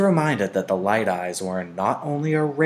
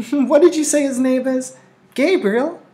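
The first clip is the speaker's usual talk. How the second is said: put-on much higher, louder bright child's question; eager little girl; 8 dB louder than usual. A narrator's reading. Lily asks the baby's name, delighted. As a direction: ask full of delight.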